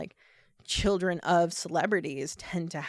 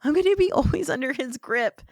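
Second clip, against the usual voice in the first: higher, louder imitated distress; mock tearful wail; 8 semitones higher, 6 dB louder than usual. Retelling daughter breaking down over dad's control, mock tearful. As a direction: act out breaking down, lightly hammed up. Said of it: "she breaks down and cries".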